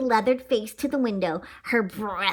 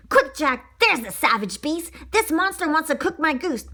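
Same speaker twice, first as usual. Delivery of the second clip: frantic; 6 semitones higher than usual. Acted. Duck begging the bear to stop the dog, frantic. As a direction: blurt it out in a panic. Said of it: "became more frantic".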